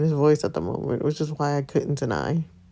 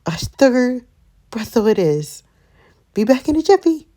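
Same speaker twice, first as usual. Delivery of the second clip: high southern granny, lisping; southern accent with a lisp; 9 semitones higher, 6 dB louder than usual. Acted. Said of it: "give her a southern accent ... and a lisp".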